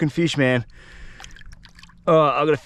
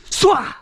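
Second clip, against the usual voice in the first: cast whoosh; 10 semitones higher, 5 dB louder than usual. Real, not acted. Casting with a big whoosh.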